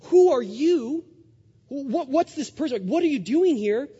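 high, loud startled questions; panicked startle. Retold or imitated. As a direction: jolt awake in alarm. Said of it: "He panics, he says".

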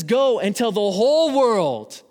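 slow, high and loud commanding call; booming, drawn-out voice of God sending out disciples; God's command. Retold or imitated. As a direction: proclaim the commission like a divine order. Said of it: "He says".

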